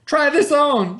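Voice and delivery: eager cry. Acted. Miss Lurleen urging Bubba, high and loud. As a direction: thrust the boot at him. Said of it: "she cried".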